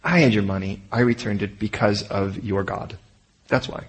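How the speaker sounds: soft, hushed reassurance; softly telling them to calm down; soothing steward. Pretend. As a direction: settle them down quietly. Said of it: "the steward's going".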